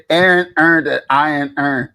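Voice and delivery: "Aaron earned an iron earn" with Baltimore vowels, slowly; Baltimore tongue twister. Shown as a demonstration. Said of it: "[name], say".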